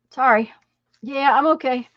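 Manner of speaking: recovering from choking, throat clearing; swallowed wrong way. Real, not acted. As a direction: clear throat hard, then rasp out sorry. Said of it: "I swallowed air".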